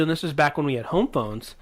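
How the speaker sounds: normal talking voice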